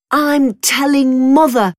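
high, loud tattletale shout; boy shouting. Acted. Brother threatens to tell on his sister, shouting. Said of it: "shouted Tom".

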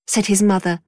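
ordinary delivery, nothing marked

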